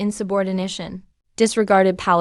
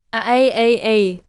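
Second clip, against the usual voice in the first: pained groans. Acted. Prince in pain as he transforms.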